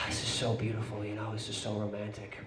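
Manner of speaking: imitated girlfriend's soft, low murmur; hushed and low, lovingly hinting; romantic whisper. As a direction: murmur it dreamily into a partner's ear. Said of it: "she whispers in my ear".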